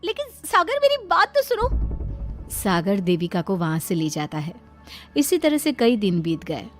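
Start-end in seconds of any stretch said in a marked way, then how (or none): 0.0-1.7 put-on very high-pitched young wife; high, insistent appeal; protesting plea. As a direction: beg him to listen, high and urgent. Wife pleads as led away.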